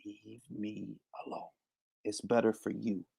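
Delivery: very low, barely audible threat; hushed warning. Pretend. Streamer warning a rival it's better for him, hushed menace. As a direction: drop right down, low and hushed.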